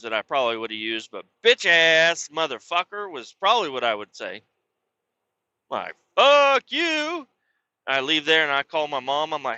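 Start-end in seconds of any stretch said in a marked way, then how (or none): 6.2-7.3 much higher, louder parting shout; retold parting yell. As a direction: fling it over your shoulder as you leave. Parting shot at stepfather.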